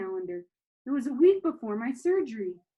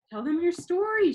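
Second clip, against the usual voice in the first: grandma voice; 8 semitones higher, 5 dB louder than usual. A narrator's reading.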